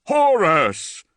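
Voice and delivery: high, loud startled cry; gasped cry. Acted. Dante crying out at the giant face, high and loud. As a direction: cry the name out in terror.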